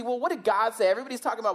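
normal talking voice

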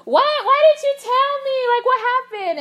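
high, loud mock astonishment; shocked friend. Speaker voices her friend learning of the crying, shocked. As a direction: imitate a friend crying out in alarmed surprise. Retold friend reacting to the confession.

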